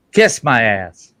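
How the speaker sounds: loud, drawn-out parrot insult; rude parrot. Acted. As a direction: blurt it slowly and loudly like a cussing parrot. Foul-mouthed parrot insults preacher.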